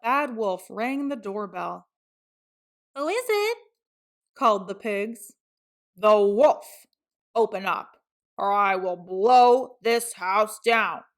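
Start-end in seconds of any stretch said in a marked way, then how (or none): 3.0-3.6 much higher squeaky pigs; high piggy voice. A narrator's reading. Pigs call through door. 6.0-11.0 louder, booming bad wolf; loud wolf threat. A narrator's reading.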